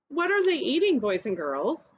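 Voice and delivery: normal talking voice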